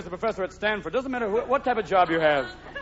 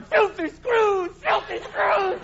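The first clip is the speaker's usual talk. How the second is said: very high, slow squawking bird shout; jailbird squawk; 12 semitones higher, much slower than usual. Retold or imitated. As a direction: screech it like a caged prison bird. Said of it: "Little birds in their cages going".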